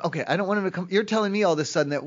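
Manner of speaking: ordinary talk, nothing marked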